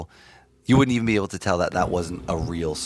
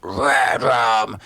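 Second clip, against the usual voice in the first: different scream timbre; 4 semitones higher, 5 dB louder than usual. Shown as a demonstration. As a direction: scream it in a less high timbre. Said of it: "go into all different timbres".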